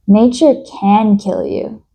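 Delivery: usual speaking voice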